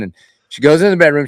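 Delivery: ordinary delivery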